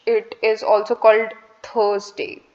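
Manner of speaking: ordinary delivery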